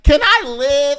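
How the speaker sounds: high, loud squeaky outburst; mock exasperation. Gymnast answers the question everyone asks, mock exasperated outburst. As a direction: cry it out high as a fed-up little gymnast.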